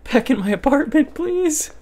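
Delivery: high, loud desperate begging; panicked plea. Real, not acted. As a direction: cry out please in panic. Fleeing danger, begging.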